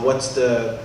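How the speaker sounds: ordinary talk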